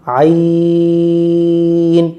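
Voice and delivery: عین drawn out long from the throat. Shown as a demonstration. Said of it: "اس type سے اس کو پڑھیں گے".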